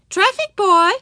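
high, loud hail; calling out. A narrator's reading. Teacher hailing the traffic boy, calling out. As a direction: call to someone across the street. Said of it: "Miss Binny called out".

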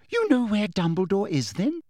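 high, eager question. A narrator's reading. Umbridge pressing Hermione for information, eager. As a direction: lean in hungry for the answer. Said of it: "said Umbridge eagerly".

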